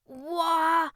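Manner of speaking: high, loud held syllable; loud attempt. Boy tries king's syllable alone, loudly. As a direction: belt out the sound.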